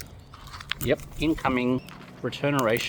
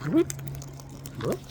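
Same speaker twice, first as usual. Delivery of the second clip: ordinary talk, nothing marked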